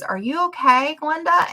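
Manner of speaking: lifted, questioning retold voice; concerned anesthesiologist. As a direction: imitate a doctor asking after a patient. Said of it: "he goes".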